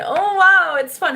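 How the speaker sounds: loud, high sarcastic "oh wow"; sarcastic wow at a troll; mock amazement. Real, not acted. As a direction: fake being impressed. Mocking troll's return.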